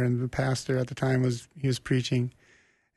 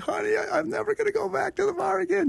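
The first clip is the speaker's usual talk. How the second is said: much higher, eager retold declaration; excited announcement. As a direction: burst through the door with big news, way up high. Bursting home to tell wife.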